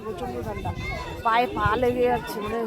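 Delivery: calling out in the cold; loud. Real, not acted. Calling out in rain on the mountain.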